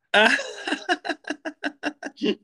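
high, hooting chimp-like laugh; chimpanzee laugh. Real, not acted. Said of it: "wie ein Schimpanse gelacht".

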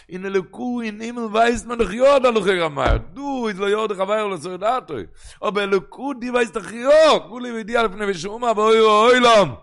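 high, loud climactic declamation; impassioned preaching. Real, not acted. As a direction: drive the argument home at full voice. (ברענענדיקע דרשה אויף א הויך קול)

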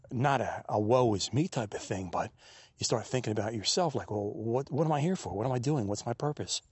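ordinary talk, nothing marked

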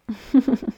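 a short chuckle through teeth; laugh through teeth. A narrator's reading. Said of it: "Ri Iona entre dentes".

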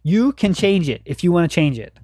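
ordinary talk

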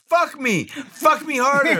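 high, slow, breathy mock moaning woman; mock porn moan. Mimicking the porn actress on screen, high mock moan. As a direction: ham up a porn star's moan, high and drawn out. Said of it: "Pinky was there like".

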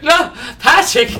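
high, loud teasing "No, Patrick!"; playful protest. Retold or imitated. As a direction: squeal it out like a flattered date. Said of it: "then I'd be like".

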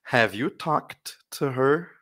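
"Have you talked to her?" slow, rising at the end; slow model reading. Shown as a demonstration. Teacher reading the question slowly for the class to repeat. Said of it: "Leiam comigo".

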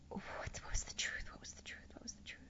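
hushed whisper to herself. Retold or imitated. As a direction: whisper the question to yourself. Asking herself quietly, alone.

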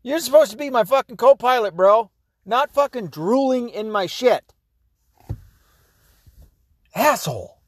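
high-pitched indignant griping; upset, indignant. Real, not acted. Owner telling off his dog over spoiled coffee, indignant. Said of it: "Now I'm so upset".

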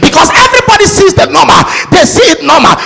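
loud, high, driving sermon shout; full-force preaching. Real, not acted. Preacher insisting to the church that delay has a purpose, full-force preaching. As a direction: hammer each phrase out to the congregation.

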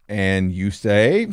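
slow, drawn-out prompt. Retold or imitated. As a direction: draw it out like a cue. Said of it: "she'd be like".

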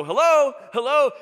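high, loud shouted hello into the phone; shouting hello. Retold or imitated. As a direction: call out hello as if she can't hear. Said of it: "And we'd go".

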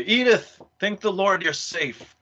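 mock urgent call to a daughter; relieved, urgent father. Acted father finding his daughter.

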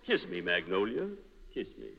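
low, soft lover's murmur; hushed romantic plea. Acted. Rehearsing a Southern love scene.

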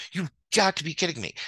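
exasperated disbelief. Real, not acted. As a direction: throw up your hands in disbelief.

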